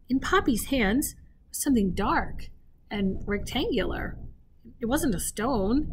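plain reading voice

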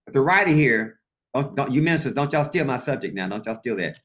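usual speaking voice

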